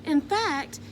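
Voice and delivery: ordinary delivery, nothing marked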